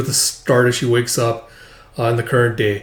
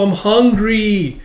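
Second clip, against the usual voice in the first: put-on high, slow child whine; lightly voiced little kid whining; 9 semitones higher, much slower than usual. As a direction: whine like a small hungry kid. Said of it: "as a little kid's like".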